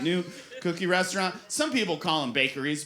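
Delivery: ordinary delivery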